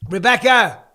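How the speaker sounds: loud, high shout for someone; real holler across the house; calling out. Real, not acted. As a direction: call your partner in from another room. Calls partner over from elsewhere.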